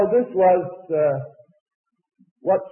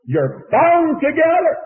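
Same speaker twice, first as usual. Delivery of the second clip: preaching loud; 12 semitones higher, 6 dB louder, slower than usual. Real, not acted. Preacher stressing unity to congregation.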